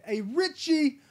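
put-on high, loud shouted Brooklyn-style Seagal accent; Seagal's Out for Justice accent. Seagal yelling for Richie, loud hammed Brooklyn accent. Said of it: "that Seagal accent".

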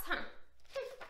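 plain reading voice

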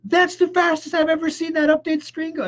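high, astonished exclaim; amazed wife. Retold or imitated. Wife watching the update finish, high and amazed. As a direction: sound truly amazed at the update speed.

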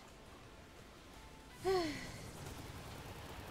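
long, voiceless weary sigh. Real, not acted. Gamer worn down by the chase, long sigh. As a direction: let out a drawn-out breath. Frustrated pause in play.

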